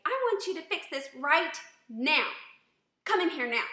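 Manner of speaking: loud, high, demanding yell; angry, disrespectful. Shown as a demonstration. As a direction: shout orders at the husband. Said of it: "angry tone of voice".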